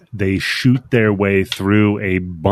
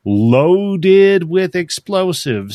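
slow, drawn-out mock villain threat; acted threat; 6 semitones higher, slower than usual. Mama warning Dredd about her kill switch, slow acted threat. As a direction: spell out the threat slowly, pitched higher.

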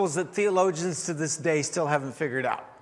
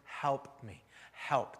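quiet plea; 5 semitones lower, 8 dB quieter, much slower than usual. Retold or imitated. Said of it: "just this cry".

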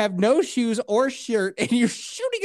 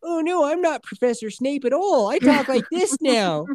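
high-pitched, hammed-up innocent act; mock villain posing as a harmless hostage; fake frightened hostage; 6 semitones higher than usual. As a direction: put on a high, phony pleading voice. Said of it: "he, like, pretends to be a hostage".

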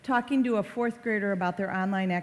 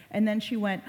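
ordinary talk

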